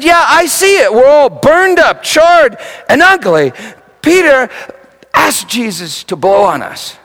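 loud, high, slowed sermon voice; full-force preaching. Acted. Preacher retelling the vision of burned ugliness, preaching hard. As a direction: drive the vision home to the crowd.

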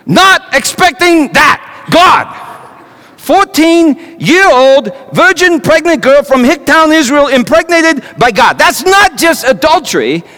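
betrayed man yelling in disbelief; outraged outburst. Acted. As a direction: let the outrage burst out of you. Joseph's outrage at the news.

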